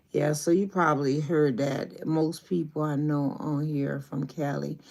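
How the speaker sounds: ordinary delivery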